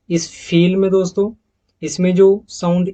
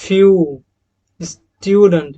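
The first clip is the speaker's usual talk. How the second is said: स्टूडेंट as British "styoodent" with a y glide, louder; British accent; 4 dB louder, much slower than usual. Shown as a demonstration. Teacher showing the British accent version: louder styoo glide. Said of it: "ये दोस्तों British accent का है".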